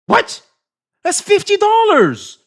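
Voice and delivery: high, loud shocked yell; "fifty dollars" shouted up high; surprised shout. Real, not acted. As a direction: blurt it out in disbelief. Reacting to big donation.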